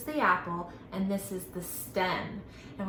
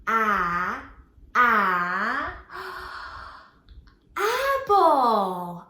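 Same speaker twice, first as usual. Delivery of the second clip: excited sound-out; 6 dB louder, much slower than usual. Shown as a demonstration. Teacher reveals the apple theme to the kids, gasp and excited reveal. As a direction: build to a delighted reveal. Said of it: "use our letter A sound one more time".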